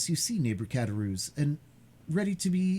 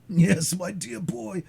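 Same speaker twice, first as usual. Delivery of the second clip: put-on old man voice; 8 semitones higher, 5 dB louder, slower than usual.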